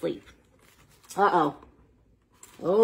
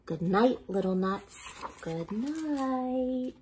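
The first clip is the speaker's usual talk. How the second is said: fading softer to a hush; soft goodnight; 4 semitones higher, 8 dB quieter, slower than usual. Acted. Reader bids children goodnight, fading to a hush. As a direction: say goodnight as if tucking them in.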